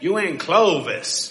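put-on slow, drawled local accent; local Clovis accent. Preacher imitating the man who told him where he was, drawled local accent. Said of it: "That's how he talked".

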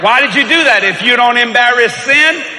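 loud high preaching, slowing at end. Acted. Preacher driving home the point loudly. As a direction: preach it to the congregation.

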